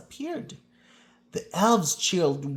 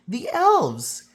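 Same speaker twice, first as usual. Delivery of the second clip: loud, high excited shout; child shouts; 9 semitones higher, 7 dB louder than usual. A narrator's reading. Child shouts to the others on the train, loud and high. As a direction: yell it out, pointing at the elves. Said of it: "shouted one of the children".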